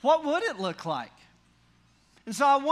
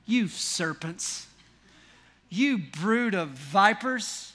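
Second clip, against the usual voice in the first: slow, measured dramatic denunciation; mock-tender rebuke; 2 semitones lower, slower than usual. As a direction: draw out each insult with grave relish. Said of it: "says to them these tender words".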